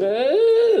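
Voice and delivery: high, rising door creak; imitated door noise. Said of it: "the door's going".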